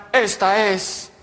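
slow, drawn-out announcer voice; slow radio announcer. Acted. Voice in the closet announcing the station, slow announcer. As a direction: announce it slowly like a radio host.

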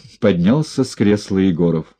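ordinary delivery, nothing marked

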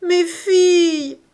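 high, drawn-out wail; anguished, slow, loud lament; tearful cry. A narrator's reading. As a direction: wail loudly in despair. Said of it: "s'écria en pleurant".

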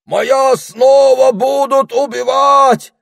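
piercing, drawn-out cries for help; верещание. A narrator's reading. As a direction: squeal in panic, very high and loud. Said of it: "заверещала мадам Гортензия". (истошно верещать)

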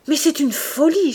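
high, loud outcry; exclamation affolée. A narrator's reading. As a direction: cry out in alarmed protest. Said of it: "s'écria-t-il". (il s'écrie, voix haute et forte)

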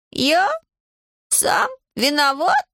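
high, drawn-out incredulous questions; astonished. A narrator's reading. Иван is blamed by Аделаида, astonished. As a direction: echo it slowly, rising high in disbelief. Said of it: "поразился Иван".

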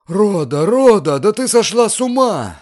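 high, loud, appalled scream; shrieking. A narrator's reading. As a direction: shriek in alarm at the girl. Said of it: "завопила госпожа Бенсусон".

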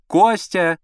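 high woman's call from another room. A narrator's reading. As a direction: call for your husband from the bedroom. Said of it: "снова раздался из спальни голос Аллы Сергеевны".

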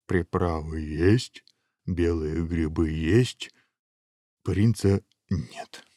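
slow, regretful stock-taking; drawn-out, disappointed big man; sighing ogre. Acted. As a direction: list it with a wistful sigh. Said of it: "вздохнул большой человек".

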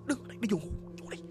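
very soft, under-the-breath bedtime order; hushed ordering. Retold or imitated. Grandma puts the child back to bed, hushed. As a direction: drop right down to a hushed murmur.

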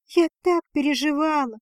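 relieved outburst, mildly done; breathed out. A narrator's reading. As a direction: let the worry out high in one breath. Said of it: "выдохнула Лузария".

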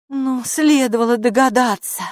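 exasperated, scoffing remark; snorting scorn. A narrator's reading. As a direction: toss it off with disdain. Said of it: "фыркнула она".

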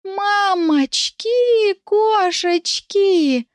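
very high, very slow panicked wail; terrified squeal. Acted. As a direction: squeal it out in terror. Scared cat attacked.

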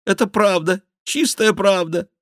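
high, loud repentant whine; put-on contrite confession; penitent mock king. A narrator's reading. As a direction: repent loudly on the king's behalf. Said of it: "каялся Шико от имени короля".

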